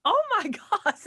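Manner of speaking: high, laughing retold amazement; awestruck. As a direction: gush in a high voice through a laugh. Retells awe at high note.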